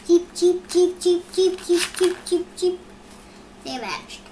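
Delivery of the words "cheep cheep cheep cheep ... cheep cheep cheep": higher, louder chirping chicks; chicks cheeping. Acted. Baby chicks on Elephant's head cheeping.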